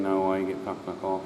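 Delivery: usual speaking voice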